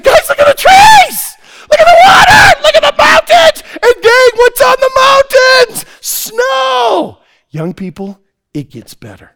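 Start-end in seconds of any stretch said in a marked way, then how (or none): 0.0-7.2 very high, loud, awestruck calls; excited shouting. Retold or imitated. Father showing the boys the view: awestruck shout. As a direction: point at every wonder and yell.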